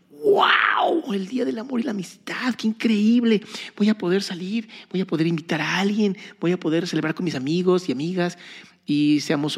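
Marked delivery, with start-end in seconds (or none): 0.1-8.4 put-on excited teen. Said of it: "cuando yo estaba bien jovencito era como de".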